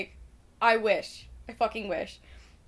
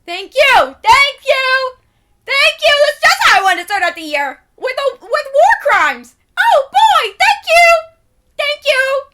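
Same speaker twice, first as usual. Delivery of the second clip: shrieked, much higher and louder mock thanks; sarcastic shouting; 15 dB louder than usual. Real, not acted. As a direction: scream your fake gratitude at the news. Sarcastic outburst at Trump.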